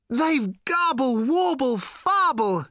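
put-on high, loud, slow quavering old man; thin tremulous shout. Slarty shouting to Ford and Arthur, thin tremulous shout. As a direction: wobble it out at full volume. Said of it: "in his thin, tremulous voice".

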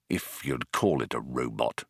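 soft, low aside; muttered. A narrator's reading. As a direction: mutter it under your breath. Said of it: "muttered Arthur".

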